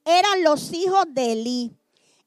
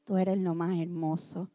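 respuesta mucho más baja y suave; Ana sumisa; 10 semitones lower, 9 dB quieter than usual. Retold or imitated. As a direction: contestar con resignación callada. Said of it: "yo creo que le decía". (Ana al marido, respuesta resignada muy baja)

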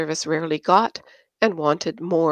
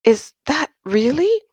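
much higher, awed disbelief; stunned; 9 semitones higher, 3 dB louder than usual. Acted. Anne reacts to the impossible graph, stunned. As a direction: gasp it in disbelief.

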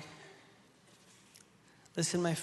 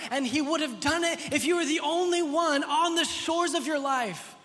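raised, driving pulpit delivery; impassioned preaching; 6 semitones higher than usual. Real, not acted. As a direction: preach it with mounting intensity and emphasis. Preaches at full force.

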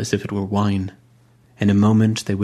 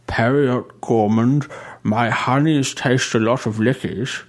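higher, prim churchman praising the funeral; fussy old bishop; 3 semitones higher than usual. A narrator's reading. Bishop listing buyers.

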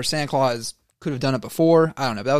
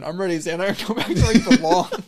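high, louder comic voice breaking into laughter; mock eager toy; 6 semitones higher, 5 dB louder than usual. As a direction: play the eager toy while cracking up.

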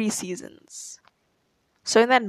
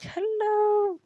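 high sing-song greeting from a stranger relative; high mock relative; 12 semitones higher than usual. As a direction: greet like an overly sweet distant aunt. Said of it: "they go like".

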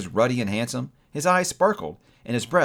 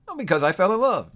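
high, quick, open-hearted answer; lifted, lively confession; cheerful nephew; 7 semitones higher, 4 dB louder than usual. A narrator's reading. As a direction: say it lightly and happily.

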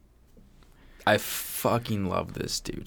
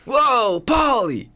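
much higher, louder cartoon shout; cartoon exclamation; 14 semitones higher, 7 dB louder, much slower than usual. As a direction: yelp it like a Family Guy cutaway gag. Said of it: "If you're on, like, a Family Guy cutaway".

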